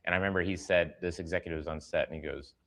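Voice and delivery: normal talking voice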